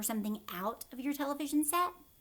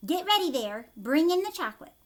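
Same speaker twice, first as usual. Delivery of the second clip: loud, higher call to the workers; 5 semitones higher, 7 dB louder than usual. Acted. Wonka ordering the Oompa Loompas, loud call. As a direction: call across the room. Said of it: "Wonka calling to Oompa Loompas".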